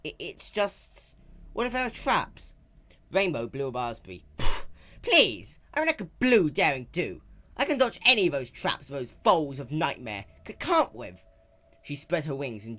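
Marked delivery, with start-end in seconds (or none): 0.0-2.5 put-on hesitant, higher scared young dragon; jittery boyish voice; nervous Spike. 4.4-11.2 raised, brash tomboy pony voice; lightly raised young heroine; Rainbow Dash voice. Acted.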